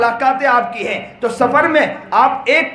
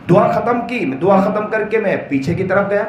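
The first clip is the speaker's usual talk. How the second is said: ordinary talk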